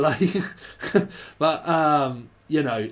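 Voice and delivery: ordinary talk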